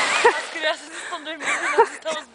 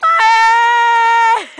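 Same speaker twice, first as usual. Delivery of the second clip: loud high cheer; excited cheer; 7 semitones higher, 10 dB louder than usual. Real, not acted. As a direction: shout a happy whoop. Cheering friend's slide.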